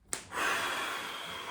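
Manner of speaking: long, breathy blow of smoke; long exhale. Retold or imitated. Telling of his first hit of the pipe, long exhale. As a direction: let out one long, slow breath.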